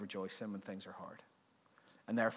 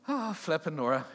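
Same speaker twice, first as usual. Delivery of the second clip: loud, higher mock groan of dismay; exasperated exclamation; 5 semitones higher, 7 dB louder than usual. Congregation reacting to the verse, loud exasperated groan. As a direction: blurt it out like a fed-up congregation. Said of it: "And everyone went".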